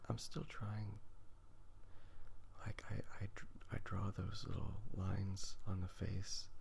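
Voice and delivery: normal talking voice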